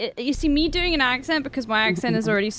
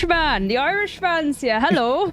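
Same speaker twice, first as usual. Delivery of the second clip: put-on Irish accent; 5 semitones higher, 3 dB louder than usual. Greeting Celtic as the Irishman, mock Irish accent. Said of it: "pick up an Irish accent".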